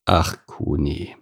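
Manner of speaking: slow, soft sigh of the words; resigned horse's sigh. A narrator's reading. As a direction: sigh it wearily and slowly. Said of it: "seufzte Alois".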